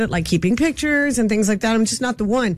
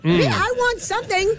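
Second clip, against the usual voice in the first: mock protest; 7 semitones higher than usual. Mock protest after saying she wants nothing.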